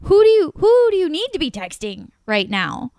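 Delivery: retold angry question. Woman retelling confronting her date at dinner, sharp and indignant. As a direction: confront him, pitch spiking on the second who. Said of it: "So I'm like".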